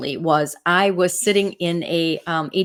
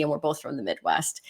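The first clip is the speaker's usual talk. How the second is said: whispered aside; 4 semitones lower, 5 dB quieter than usual. Real, not acted. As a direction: whisper it softly, an aside to the viewer. Quick aside after sassy remark.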